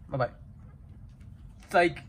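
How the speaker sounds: normal talking voice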